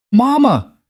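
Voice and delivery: high, loud cry of recognition; a little girl spotting her mother in a crowd; joyful child's cry. Acted. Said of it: "Then she gasped".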